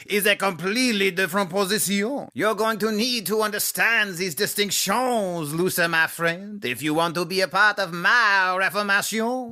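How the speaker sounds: put-on light French accent; french chef. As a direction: lecture in a comic French accent. Calvin lectures in French accent.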